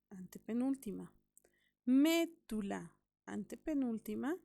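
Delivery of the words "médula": médula slowly, with a loud mé; slow teacher's demo of médula, first syllable loud and split off; stress on antepenultimate syllable. Shown as a demonstration. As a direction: punch mé, then tula, in médula. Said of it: "va a estar en la antepenúltima sílaba".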